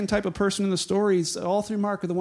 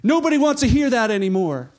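higher, louder acted rebuke; irritated scolding; 6 semitones higher, 6 dB louder than usual. Annoyed crowd orders blind man to stop calling, loud scolding. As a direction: build from a sharp stop to a loud telling-off.

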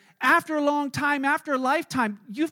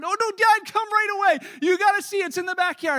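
put-on high, louder child voice, insistent; excited kid; 8 semitones higher, 5 dB louder than usual. Son pulling his dad to the backyard, high eager voice. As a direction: urge like a kid who can't wait. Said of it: "And I'm like".

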